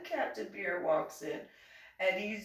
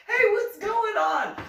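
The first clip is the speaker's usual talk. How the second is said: much higher, louder, slow and sing-song; bright greeting; 13 semitones higher, 8 dB louder, slower than usual. Retold or imitated. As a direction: breeze in all cheery and oblivious. Cheerful greeting at medical hold.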